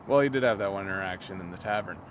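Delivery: ordinary delivery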